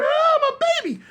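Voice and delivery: very high, acted maternal wail; hollering mother. KC's mama running to her son, high-pitched hollering. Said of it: "she run to him hollering".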